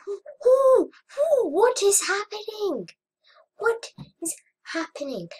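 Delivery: high, wailing whoops and questions; alarmed bafflement. Real, not acted. Gamer baffled by what is happening on screen, alarmed wail. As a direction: cry out high, easing down by the end. Baffled panic at game events.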